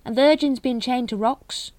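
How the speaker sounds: high-pitched knowing suggestion. A narrator's reading. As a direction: offer it like one in the know. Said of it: "said Nobbs knowingly".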